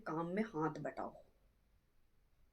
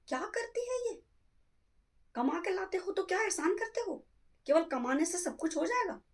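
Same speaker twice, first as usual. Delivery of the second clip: much higher, sharp indignant scolding; indignant rebuke; 11 semitones higher than usual. Acted. Mother-in-law rebuking her son for yelling at his wife, high indignant scold. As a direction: scold him for boasting about earning.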